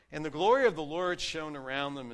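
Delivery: proclaimed, rising on "glory"; scripture reading. Acted. Pastor quoting Luke again to his congregation, proclaiming. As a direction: lift the verse up high. Rereads the verse.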